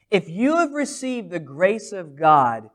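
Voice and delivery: ordinary delivery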